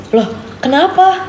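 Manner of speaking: high, slow puzzled question; a drawn-out confused why; retelling her puzzled reply to him; surprised. Retold or imitated. Retold argument, her own reply.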